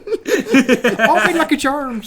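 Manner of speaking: laughing. Pretend. Joking leprechaun bit, laughing.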